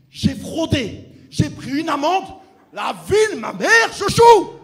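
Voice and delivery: furious comic rant rising to a scream; building shout. Retold or imitated. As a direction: rant until you explode. Ranting to get the gig.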